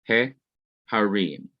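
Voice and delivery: He, then a clear pause before harim; pause after He. Shown as a demonstration. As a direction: set He apart, then say harim with a falling end. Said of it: "there is a pause after the definite article".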